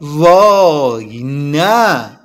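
very drawn-out "oh no"; slow, wavering moan of dread; gulping dread. A narrator's reading. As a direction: stretch each word in dismay. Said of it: "آب دهانش رو قورت داد و گفت".

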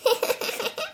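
high light giggle; a small amused laugh; snail laughing. Acted. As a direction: Snail laughing at Franklin's question. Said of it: "حلزون خندید".

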